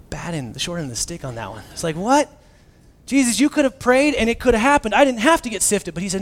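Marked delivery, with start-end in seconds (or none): 1.9-5.9 higher, louder outraged what; Peter's outraged protest, pitched up and louder; indignant protest. Retold or imitated. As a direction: complain you got the short end.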